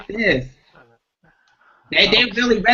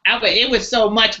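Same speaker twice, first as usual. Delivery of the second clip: ordinary delivery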